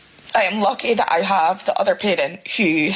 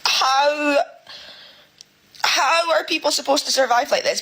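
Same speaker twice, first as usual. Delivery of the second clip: raised, upset plea; really emotional; 6 semitones higher than usual. Real, not acted. Single parent asking how people survive, really emotional. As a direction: plead it with real emotion, voice pitched up. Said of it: "I'm getting really emotional".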